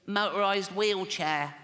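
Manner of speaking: put-on slow, strained child's voice, far from his own. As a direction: drag out the words like a small boy who is struggling. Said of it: "I promised Jane I wouldn't do the voice".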